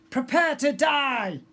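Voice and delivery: high, loud slow threatening snarl; hissed. A narrator's reading. Pirate girl threatening the prince to his face, slow and fierce. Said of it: "Tempestra hissed in the prince's face".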